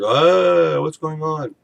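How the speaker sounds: high, loud startled "what's going on"; confused surprise. Retold or imitated. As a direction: blurt it out, suddenly thrown. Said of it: "And he's like".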